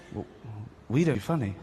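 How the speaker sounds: usual speaking voice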